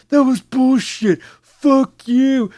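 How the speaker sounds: high, loud, slow mock tantrum; mock rage. Gamer mocking a sore loser's loud rage. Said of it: "wasn't going around going".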